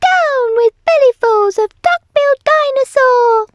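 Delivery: loud, high sustained dinosaur hoot; piercing little-dinosaur hoot. A narrator's reading. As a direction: hoot the whole chant up high. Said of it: "he hooted".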